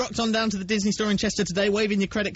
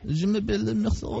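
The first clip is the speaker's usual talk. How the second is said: slow mock-French delivery; French accent. Host introducing himself to caller as a Frenchman, French accent. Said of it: "I love your French accent".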